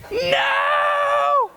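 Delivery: long high shouted no; scream. Retold or imitated. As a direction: scream it in dread. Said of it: "you could hear me scream".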